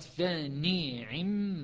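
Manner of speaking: فنعم drawn out very slowly with kasra on the ain and doubled mim; Hafs reading. Shown as a demonstration. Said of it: "قراءة حفص". («فنعم» بقراءة حفص ببطء وكسر العين)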